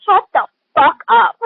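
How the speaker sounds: loud, slow and high, fed up with her; mock yell at a chatty mother; annoyed shout. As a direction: snap it out slowly, exasperated. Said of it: "I'm just like".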